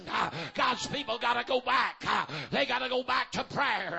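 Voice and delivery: chanted, whooping preacher cadence with a gasped "uh"; full-force preaching. Acted. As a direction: preach it out hard, hammering each line.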